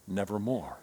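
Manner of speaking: low, soft, ominous raven's word; quiet raven reply. Retold or imitated. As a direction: let the raven answer softly and darkly. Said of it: "The bird says".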